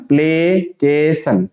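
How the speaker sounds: slow, stretched "प्लेकेशन"; syllable by syllable. Shown as a demonstration. Teacher showing students how to read it, slowly in syllables. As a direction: break "प्लेकेशन" into play, kay, san. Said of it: "इसको पढ़ने के लिए".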